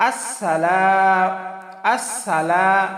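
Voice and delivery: আসসালা- with a long held la; stretched lam. Shown as a demonstration. Teacher shows one-alif stretch, আসসালা- with long la. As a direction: draw out the end of আসসালা- melodically. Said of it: "লামতে একালিপ টান".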